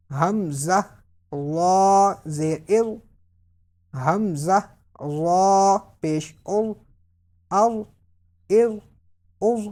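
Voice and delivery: long heavy "وا" as emphatic zaa, slow spelling; measured drill, rounded heavy letters; full-mouthed. Shown as a demonstration. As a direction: end "ال" on a thick, unwhistled dh. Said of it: "پر پڑھنا ہے".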